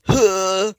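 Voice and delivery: loud high huh grunt; punchy voiced grunt. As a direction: grunt it out for the character. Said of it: "Now I go".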